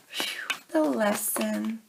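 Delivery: breathy whispered sigh into the words. Real, not acted. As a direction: let out a breath, then whisper. Card reveal, breath out.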